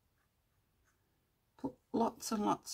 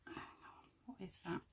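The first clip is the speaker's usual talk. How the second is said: hushed whisper to herself; 15 dB quieter than usual. Real, not acted. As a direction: breathe it out under your breath. Muttering to herself while crafting.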